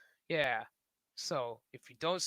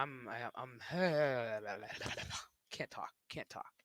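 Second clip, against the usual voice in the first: laughing; 4 semitones lower than usual. Real, not acted. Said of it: "I'm running out of words".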